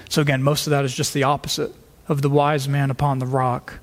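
usual speaking voice

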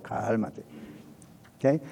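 low, soft, drawn-out calming word; soothing. Acted. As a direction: talk yourself down softly. Se aconseja calma a sí mismo. (tranquilizador, bajo y suave)

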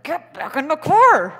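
a loud, tense yell forced through clenched teeth; hiss-yell at a reckless tourist to get back; hushed yell through gritted teeth. Retold or imitated. Tourists yell at a woman by the rhino through gritted teeth. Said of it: "quietly yelling at her".

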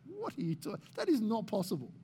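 flabbergasted objection, kept quiet; incredulous. Acted. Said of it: "would be incredulous about that".

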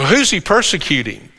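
usual speaking voice

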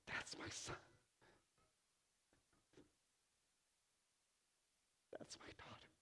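whispered proud father; tender whisper. Acted. God speaking proudly over his children, soft whisper. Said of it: "And God says".

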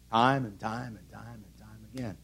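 soft weary repetition. Acted.

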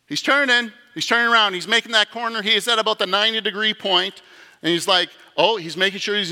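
high and loud, building suspense; rising excitement. Acted. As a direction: call it like a tense play-by-play. Narrating sinner turning, rising excitement.

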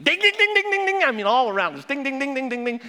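high, loud mock ding-ding of a car alarm; imitated chime. Car starts dinging.

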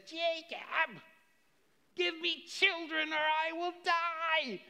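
high, loud anguished plea; desperate Rachel. Acted. Wife pleading with her husband for children, anguished and high. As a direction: beg him like your life depends on it. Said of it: "You can just hear the angst in her voice".

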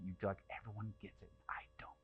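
whispered envious inner voice; jealous whisper. Retold or imitated. Voicing jealous inner thought.

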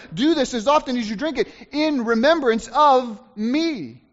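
high, loud, emphatic Bible reading; ringing pulpit reading, leaning on key words; declaimed scripture reading. Acted. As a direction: read the verse out to the whole church. Reads scripture aloud with force.

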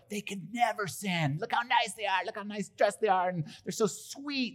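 high, sugary mock admiration; mock admiring. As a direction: gush over them in a high mocking voice. Mocking people admired as nice.

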